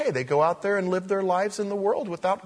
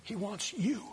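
tender; 9 dB quieter, slower than usual. Real, not acted. Tender climax after pause.

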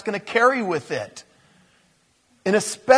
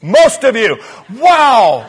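booming, astonished exclamation; big incredulous outburst; loud amazement; 5 semitones higher, 11 dB louder, slower than usual. Real, not acted. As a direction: blurt it out loud and higher. Amazed reaction to the audience's hands.

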